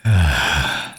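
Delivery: long falling sigh; weary sigh. Acted. Vampire facing a distrustful farmer, weary sigh. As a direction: let out a heavy breath.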